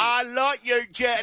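high Southern Gump voice; Forrest Gump. Acted. Co-host joking along as Forrest Gump, Southern accent. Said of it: "It's like Forrest Gump".

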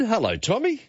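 normal talking voice

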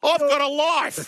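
high, slow exclamation; indignant retort. Real, not acted. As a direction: protest it slowly, way up in pitch. Indignant retort to board game question.

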